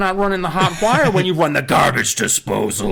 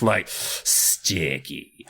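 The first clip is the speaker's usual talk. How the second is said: slow creepy drawl; 8 semitones lower, 6 dB quieter, much slower than usual. Retold or imitated. Describing the creature.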